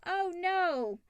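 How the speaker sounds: ordinary talk, nothing marked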